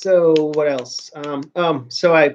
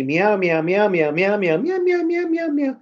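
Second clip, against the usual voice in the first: meows climbing into a high whiny cat cry; imitating a cat meowing; 4 semitones higher, slower than usual. Retelling the cat's demands, meowing like her. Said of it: "she was meowing at me".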